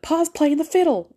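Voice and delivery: put-on high, sing-song pioneer girl voice; old-timey prairie voice. Mocking cozy winters of old, in a high prairie-girl voice. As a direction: play a wholesome prairie child cheerfully. Little House on the Prairie pastiche.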